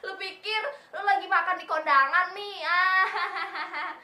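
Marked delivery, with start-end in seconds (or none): none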